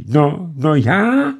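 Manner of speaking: slow, high, halting; stammering fright. A narrator's reading. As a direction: stammer in panic. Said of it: "koktal polekaný staroch". (vyděšeně koktat)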